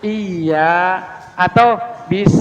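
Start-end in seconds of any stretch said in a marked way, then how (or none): 0.0-1.0 slow "Iya" with a long thin i; smiling kasrah. Shown as a demonstration. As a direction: say "Iya" through a smile, stretching the i. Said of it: "kayak orang senyum". ("Iya" dengan kasrah tipis)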